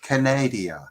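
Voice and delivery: "Canada" said as "ca-NAY-dee-a"; Kamala Harris. Retold or imitated. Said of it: "do a Kamala Harris im-, impression".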